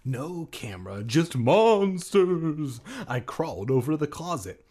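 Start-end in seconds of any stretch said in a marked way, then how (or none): 1.0-2.8 slow, louder horror voice; spooky voice. Acted. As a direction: drag it out like a horror movie. Spooky joke punchline.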